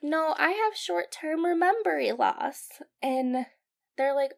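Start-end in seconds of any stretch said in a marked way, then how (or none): 0.0-2.7 lightly voiced high child; a hint of an innocent little fish; little Dory. Young fish answering her dad, lightly imitated. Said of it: "she's like".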